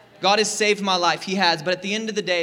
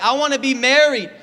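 higher, louder pleading of a lonely single; longing whine; 4 semitones higher, 4 dB louder than usual. Retold or imitated. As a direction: whine it like you're desperate for love. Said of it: "you're like".

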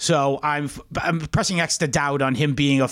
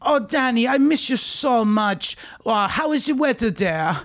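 imitating Nico; 6 semitones higher than usual. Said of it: "Nico's like".

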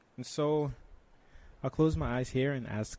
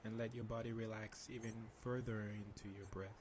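soft spa whisper; 16 dB quieter than usual. Shown as a demonstration. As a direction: guide them into calm, very softly. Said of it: "can you lead us through a meditation".

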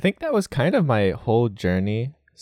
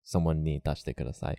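quiet stiff textbook Japanese; 4 semitones lower, 7 dB quieter, faster than usual. Shown as a demonstration. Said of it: "it's not natural".

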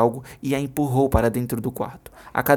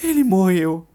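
grieving; 7 semitones higher, 5 dB louder, slower than usual. Acted. Mother insists grieving.

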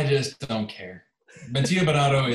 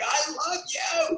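high, drawn-out squeal of love; mock adoring fan; slower than usual. A McLaren fan standing in the middle of the track, mock adoring squeal. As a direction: squeal it out in falsetto, stretched slowly.

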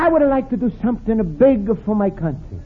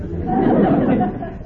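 chuckle. Acted. Luigi laughs at his joke.

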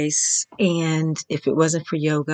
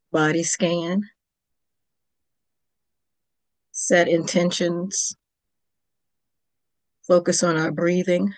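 calm, unhurried Yoga Nidra guidance with long pauses; yoga teacher leading a guided relaxation, calm and measured; guided meditation. Acted. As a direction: gently guide the listener toward sleep. Leads a Yoga Nidra session.

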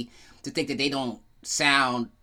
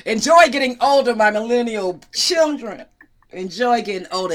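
mock grand blessing; 7 semitones higher, 8 dB louder than usual. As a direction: bless the kids like a smug elder. Mock blessing to millennial kids.

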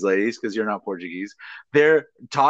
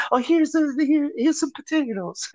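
mock mom voice, much higher, sugary; mom's fake-nice offer; 14 semitones higher than usual. Son mimics his mother turning sweet to hand over potatoes. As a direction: switch to a sweet high offering voice. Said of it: "she's not good".

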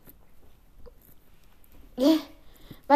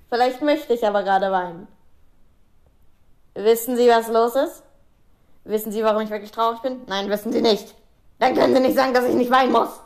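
defiant pupil; 4 semitones lower than usual. Acted. Acted pupil's retort, low voice.

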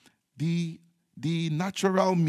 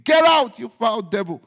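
high, loud shouted command, then easing off; rebuking the devil; 6 semitones higher, 8 dB louder than usual. Acted. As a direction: cast out the devil with a sudden shout.

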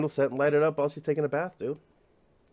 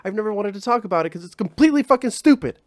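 higher, louder, fast gushing mock excitement; so excited; 8 semitones higher, 5 dB louder, faster than usual. Gwyneth gushes to her lover, mock excited and fast. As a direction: blurt it out as if bursting with the idea. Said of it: "she's, like, so excited".

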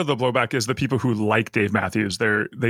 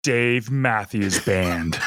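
slow deadpan; much slower than usual. Pretend. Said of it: "I'll be April".

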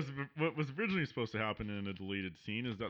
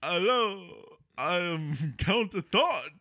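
slow, louder Count-style greeting; vampire voice; 5 semitones higher, 6 dB louder, slower than usual. Pretend. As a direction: introduce yourself as a hammy vampire. Vampire introduction.

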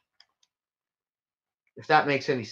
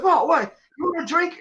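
high, louder starstruck bartender; 13 semitones higher, 3 dB louder than usual. Retold or imitated. Bartender meeting a supposed star, starstruck and high. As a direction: gush like a dazzled barman. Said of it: "Guy goes".

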